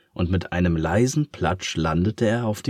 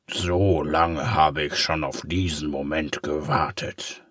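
lightly voiced gloat; a hint of villainous relish; 3 semitones lower than usual. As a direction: savour the moment.

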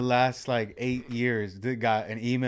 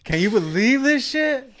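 mock disbelief; 10 semitones higher, 6 dB louder, slower than usual. Mock outrage, voiced.

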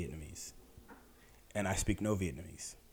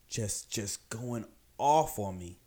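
ordinary talk, nothing marked

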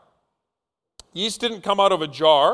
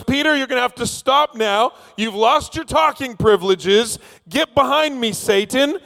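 high-pitched, comic telling-off; mock stern rebuke; 7 semitones higher than usual. Jesus rebuking Peter before all the disciples, mock stern. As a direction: scold like an exasperated teacher, pitched up. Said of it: "and then he rebukes Peter".

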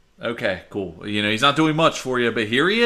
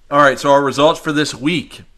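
loud, slow results announcement; announcer reveal; 7 dB louder than usual. Acted. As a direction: build up to the winner.

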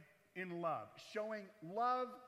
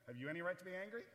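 ordinary talk, nothing marked

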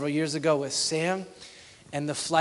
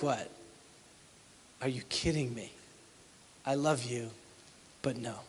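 deadpan refusal; 4 semitones lower, 9 dB quieter than usual. Retold or imitated. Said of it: "Now you're like".

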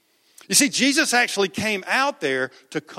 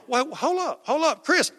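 gentle interruption; 7 semitones higher than usual. Acted. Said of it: "He's sitting there going".

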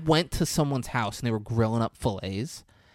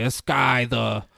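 lightly put-on tipsy dad voice; weird voice; 5 dB louder, slower than usual. Tipsy dad tells a farting joke, weird voice. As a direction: imitate a drunk dad's odd joke voice. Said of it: "he's talking in a weird voice".

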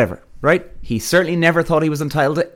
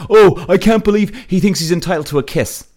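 higher-pitched imitation of indignant commenters; mock outrage; 5 semitones higher, 3 dB louder than usual. Social media critics piling on about a kiss, mock outrage. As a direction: put on an offended online pile-on voice. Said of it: "they're all like".

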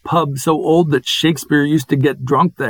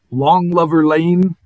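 normal talking voice

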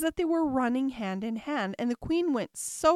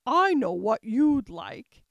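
loud, slow, lightly voiced queen; good-natured queen; 8 dB louder, slower than usual. A narrator's reading. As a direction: offer it slowly and loudly, with a touch of good nature. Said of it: "the queen said good-naturedly".

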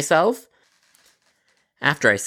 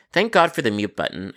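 ordinary delivery

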